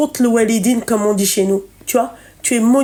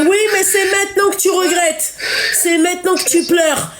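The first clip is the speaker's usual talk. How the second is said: higher, raised voice, scolding hard; heated scolding; 5 semitones higher than usual. Real, not acted. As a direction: tell her off in a raised voice. Scolding caller who regrets. (réprimande haussée, voix forte)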